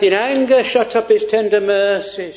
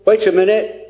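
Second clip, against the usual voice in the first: normal talking voice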